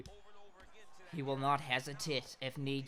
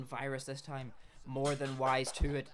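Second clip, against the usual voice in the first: usual speaking voice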